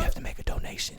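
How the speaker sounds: high, breathy hushed voice; a vendor speaking on the quiet. Retold or imitated.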